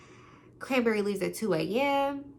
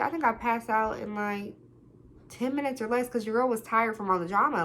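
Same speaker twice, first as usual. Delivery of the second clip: ordinary talk, nothing marked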